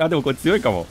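ordinary talk, nothing marked

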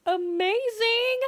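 high, drawn-out gushing; mock amazed. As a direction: gush with wide-eyed delight. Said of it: "and is like".

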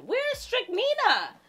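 high, slow mock-frantic question; imitated viewer. A viewer voiced hunting for the bottle, high mock panic. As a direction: squeal it as if searching in panic. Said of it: "Somebody might be saying".

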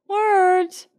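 high-pitched, dazed question; blank disbelief. A narrator's reading. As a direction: echo it blankly, not understanding. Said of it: "someone whispered blankly".

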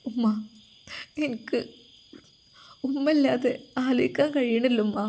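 a lightly voiced daughter, near tears; tearful daughter. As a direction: choke it out to mother. Daughter cries to mother.

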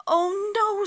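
very high, loud wail; exasperated bear. A narrator's reading. Mister Bear woken by birds and sun, desperately exasperated. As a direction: let the dismay climb right up.

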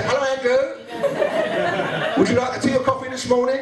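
high, sing-song fake politeness; slow, sugary mock courtesy; forced cheer. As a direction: put on a sickly cheerful greeting. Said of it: "play out this charade and say".

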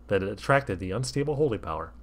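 usual speaking voice

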